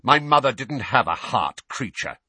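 loud, irritated retort; snapped. A narrator's reading. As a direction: snap back at the servant. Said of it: "snapped Sirius".

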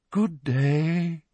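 slow, airy seer voice; misty dreamy voice. A narrator's reading. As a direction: float the words like a mystic. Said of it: "in her usual misty, dreamy voice".

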